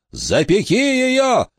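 high, slow desperate shout. A narrator's reading. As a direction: scream the command with everything. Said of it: "закричал он".

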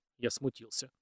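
ordinary delivery

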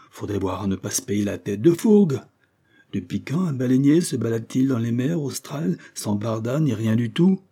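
exasperated captain's tirade; explosive indignation. A narrator's reading. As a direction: berate the crew in disbelief. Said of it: "explosa Fourgue".

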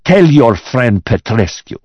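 threatening boss. Acted. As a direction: deliver the threat with growing deliberation.

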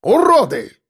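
high parting shout; insult shout. A narrator's reading. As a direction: hurl the insult from the door. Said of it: "осмелел и ещё бросил нам вслед".

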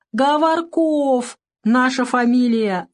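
put-on high, slow, drawn-out child's voice; tearful little boy. A narrator's reading. Boy tells the doctor his surname, whining. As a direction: stretch each word in a childish whine.

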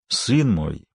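understated, weighty address; a touch of restrained power; powerful voice. A narrator's reading. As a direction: say it with quiet authority. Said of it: "произнёс он мощным голосом".

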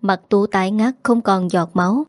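ordinary delivery, nothing marked

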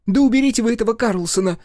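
high, fast, loud outburst; losing patience. A narrator's reading. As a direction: finally snap at him. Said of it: "не выдержала Машка".

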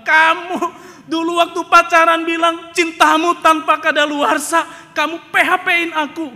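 loud, high tearful accusation; wife shrieking at her husband; crying wife. Retold or imitated. Wife confronting husband over the marriage papers, shrill and loud. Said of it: "Istrinya bilang".

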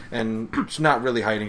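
ordinary talk

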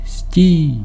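high, held command to halt; angry cry. A narrator's reading. Angry voice ordering the sailor to halt, high cry. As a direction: call it out high, as if across water. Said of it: "закричав сердито голос".

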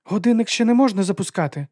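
high, insistent announcement; firm objection. A narrator's reading. Lady tries to stop the launch.

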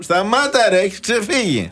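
a hint of an angry driver's yell; mock shout. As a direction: shout it high at the other driver. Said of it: "Και του φωνάζει".

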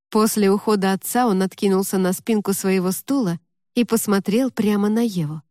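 ordinary delivery, nothing marked

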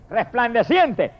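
normal talking voice

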